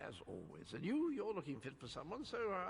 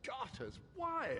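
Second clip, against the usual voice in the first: surprised exclamation; 7 semitones higher than usual. Acted. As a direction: yelp it in mock surprise.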